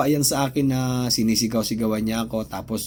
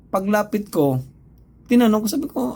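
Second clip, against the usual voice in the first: ordinary talk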